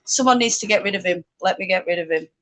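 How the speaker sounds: usual speaking voice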